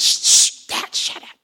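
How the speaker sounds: whispered shushing; urgent hushing. Acted. Embarrassed son to shouting father in library, whispered hush. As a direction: hiss for silence in a whisper.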